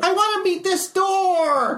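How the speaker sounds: squeaky high character voice, a bit slow; high Calvin voice. Acted. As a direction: voice the little construct brightly.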